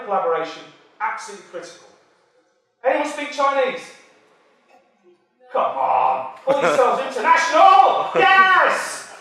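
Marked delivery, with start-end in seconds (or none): 5.5-9.1 loud teasing shout at the crowd, much higher; rally the room, shouting them on; jokingly berating the audience at full volume; calling out. Real, not acted. Urging silent audience to answer.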